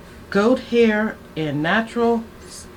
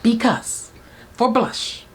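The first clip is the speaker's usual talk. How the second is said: ordinary talk, nothing marked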